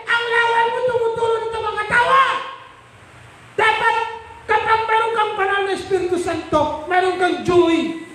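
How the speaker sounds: high, forceful pulpit delivery; impassioned sermon, pitched high throughout; full-force preaching. Acted.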